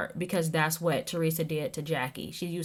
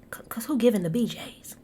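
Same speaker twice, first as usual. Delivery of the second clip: whispered gossipy aside; 4 semitones higher than usual. Real, not acted. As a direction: lean in and whisper it like a secret. Gossipy aside about a rumor.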